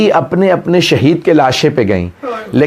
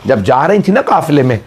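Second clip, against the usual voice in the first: normal talking voice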